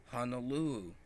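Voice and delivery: ordinary delivery, nothing marked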